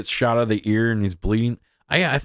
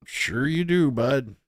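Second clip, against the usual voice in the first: sarcastic; 5 semitones higher, slower than usual. Real, not acted. Sarcastic reply to clip.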